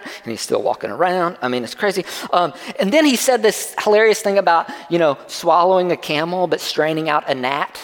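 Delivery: normal talking voice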